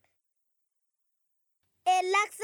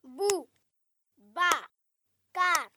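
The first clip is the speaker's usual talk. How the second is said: Bou, ba, car each said alone with pauses between; syllable by syllable. Shown as a demonstration. Pupil clapping out Boubacar for listening classes, separate syllables. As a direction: clap out Bou, ba, car one syllable per beat. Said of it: "en frappant des mains sur chaque syllabe".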